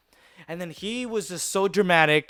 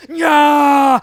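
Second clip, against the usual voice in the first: loud, high mock war yell; rage-mode battle cry; 10 semitones higher, 12 dB louder than usual. Kid charging the bigger boy, mock raging battle cry. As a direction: roar as if transforming for battle. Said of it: "went into rage mode".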